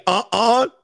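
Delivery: high, scoffing denial; dismissive uh-uh. Retold or imitated. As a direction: brush it off mockingly. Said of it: "don't say".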